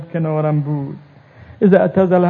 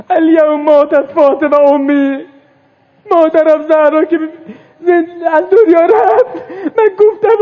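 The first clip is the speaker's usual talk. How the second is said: high wailing sob; crying lament; 9 semitones higher, 6 dB louder than usual. Acted. Preacher voices Zainab's crying lament, high and sobbing. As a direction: voice breaking as she cries out. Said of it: "فریاد زد".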